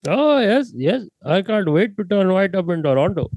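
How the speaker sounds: high, put-on Indian accent; mock Indian accent. Joking with friends about Toronto, in a put-on Indian accent. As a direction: play along in a hammed-up Indian accent.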